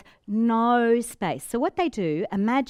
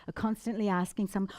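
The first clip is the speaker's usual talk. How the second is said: ordinary talk, nothing marked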